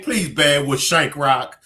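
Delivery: normal talking voice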